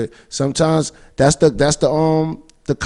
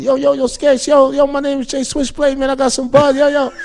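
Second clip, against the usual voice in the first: much higher eager hustler voice; pushy fan; 12 semitones higher than usual. Retold or imitated. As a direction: hype yourself up too hard. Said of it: "the song start off the way it started with".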